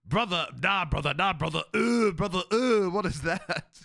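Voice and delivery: high, loud mock disgust; hammed-up high-pitched revulsion; meme impression. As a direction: play up the appalled "brother, ugh" meme. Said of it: "everyone going".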